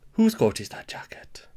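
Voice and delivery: whispered Welsh practice phrase; Welsh accent warm-up. Acted. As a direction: whisper it under your breath to find the Welsh accent. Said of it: "use the Jimmy Carr technique to try and get into it".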